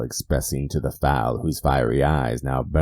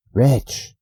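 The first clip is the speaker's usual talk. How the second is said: high, loud, desperate cry; anguished cry; 10 semitones higher, 5 dB louder than usual. Acted. The narrator cries out at the raven, high and loud. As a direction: cry out at the bird. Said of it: "I cried".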